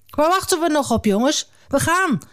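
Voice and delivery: high, eager call to his sons to set off; rally the family to leave now. Acted. Father calling his sons to leave, high and eager. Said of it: "riep Jacob".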